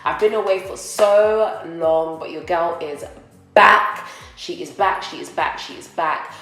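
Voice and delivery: normal talking voice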